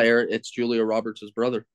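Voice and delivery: ordinary talk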